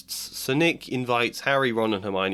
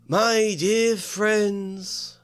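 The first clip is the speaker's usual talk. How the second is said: very slow high drawn-out greeting; a sorrowful host, stretched out; mournful; 10 semitones higher, 4 dB louder, much slower than usual. Acted. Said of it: "he said mournfully".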